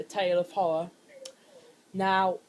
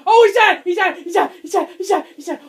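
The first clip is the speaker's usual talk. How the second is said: high, loud frantic yells; panicked shouting; 9 semitones higher, 12 dB louder than usual. Real, not acted. Gamer spotting the monster, yelling in panic. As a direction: yell it over and over in panic.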